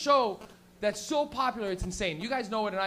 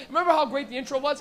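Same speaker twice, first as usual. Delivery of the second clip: normal talking voice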